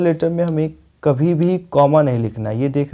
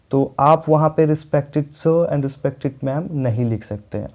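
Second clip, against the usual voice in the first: dragging each word out slowly; slow emphatic warning; slower than usual. Shown as a demonstration. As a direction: spell the rule out for the class. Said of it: "जब भी आप".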